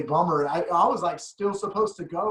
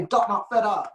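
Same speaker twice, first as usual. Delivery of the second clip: light Trinidadian lilt, a bit higher; Trinidadian accent; 5 semitones higher, 4 dB louder than usual. Retold or imitated. Quoting Adam's saying in a light Trinidadian accent. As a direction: quote the friend's accent lightly. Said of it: "And Adam used to say".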